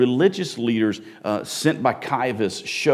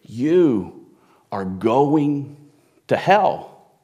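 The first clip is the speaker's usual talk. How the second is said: Jesus condemning; 2 semitones higher, much slower than usual. Retold or imitated. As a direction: pause between each word, then land the last one hard. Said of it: "Jesus looks at them and he goes".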